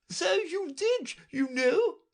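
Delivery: very high, imperious royal voice; Red Queen. A narrator's reading. Red Queen lecturing Alice, high and imperious. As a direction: talk down to the child.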